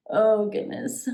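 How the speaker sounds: ordinary delivery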